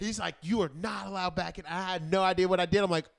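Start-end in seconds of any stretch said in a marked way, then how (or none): 0.4-1.6 mildly raised, higher-pitched telling-off; mock scolding from an angry owner; retold yelling. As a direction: imitate an owner yelling, only lightly. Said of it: "came and yelled at me".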